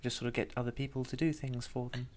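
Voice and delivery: soft, high and refined, barely projecting; posh lady. Shown as a demonstration. Demonstrating the posh lady voice to the other player, quiet and high. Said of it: "'Cause she's so quiet".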